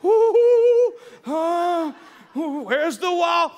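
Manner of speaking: high, loud startled ooh then slow; walking blind through a dark house; mock fearful groping. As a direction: fumble nervously in the dark.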